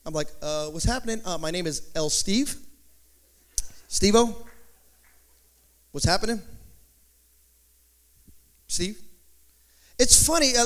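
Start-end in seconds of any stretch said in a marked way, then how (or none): 0.4-9.0 low, soft, hesitant English introduction; understated mock-casual hello; non-Spanish self-introduction. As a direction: play it down, low and casual, with little pauses. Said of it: "they don't speak no Spanish".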